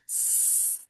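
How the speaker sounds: soft, long hiss on "S"; sustained voiceless "S"; prolonged S. Shown as a demonstration. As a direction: hold sss without stopping. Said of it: "é sem pausa".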